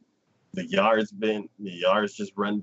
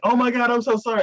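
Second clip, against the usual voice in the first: high, flustered apology; flustered dad; 12 semitones higher, 4 dB louder, faster than usual. Retold or imitated. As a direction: apologize to the maid.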